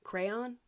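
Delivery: Crayon said "CRAY-on", two full syllables. Shown as a demonstration. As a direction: Crayon with a clear "on", rising like a question. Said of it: "How else do you say it?".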